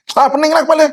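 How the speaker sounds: high-pitched exasperated outburst; mock knee-jerk complaint; spontaneous reaction. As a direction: blurt it out instantly, pitch jumping up. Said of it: "dia reaksi spontan".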